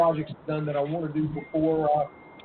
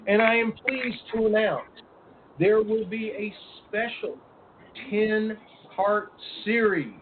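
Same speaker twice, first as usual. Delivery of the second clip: slow, spaced-out, raised announcer delivery; grand announcement; 4 semitones higher, slower than usual. Pretend. Host revealing a new series to listeners, grand slow announcement. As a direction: draw out the big reveal word by word.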